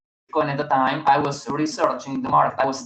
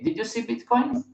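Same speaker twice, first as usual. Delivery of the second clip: imitating John; 8 semitones higher, 4 dB quieter than usual. Friend John asks.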